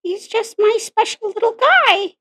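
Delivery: high, slow raspy Marge; Marge Simpson voice. Shown as a demonstration. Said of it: "I do a really good Marge impression".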